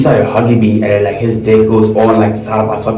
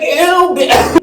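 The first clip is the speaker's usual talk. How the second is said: high, disgusted "ew"; grossed-out squeal. Retold or imitated. Disgusted reaction quoted from story.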